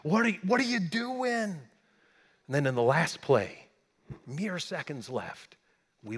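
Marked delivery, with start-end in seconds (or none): 0.0-1.7 higher, mock exasperated cry at the game; a fan pleading with his team. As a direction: groan at the team's penalties in disbelief. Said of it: "I'm sitting there going".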